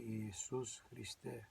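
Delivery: "Jesus Christe" said the Greek way, ee-ee-SOOS khree-STEH; Greek pronunciation. Shown as a demonstration. Believer showing how the Lord's name is said, "Jesus Christe" in Greek. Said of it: "in Greek will be".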